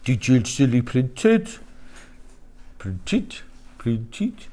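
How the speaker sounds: trying an unknown regional accent on "printed"; "printed" drawled low in a mystery English accent; unplaceable accent. Shown as a demonstration. Presenter toys with "printed" in an unknown accent. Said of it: "I don't know what accent that is".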